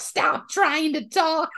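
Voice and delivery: a slow, high nagging order; scolding niece. Retold or imitated. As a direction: play the bossy niece telling her off.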